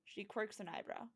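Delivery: usual speaking voice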